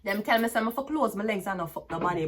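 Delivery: ordinary talk, nothing marked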